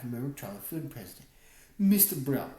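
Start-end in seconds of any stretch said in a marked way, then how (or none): 1.8-2.5 put-on Mister Brown in a thick Russian accent; Russian woman's heavy accent. A narrator's reading. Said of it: "she said with a thick Russian accent".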